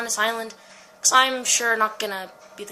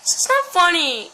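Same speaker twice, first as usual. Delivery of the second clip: loud protest; 5 semitones higher, 8 dB louder than usual. Real, not acted. Scared frustration.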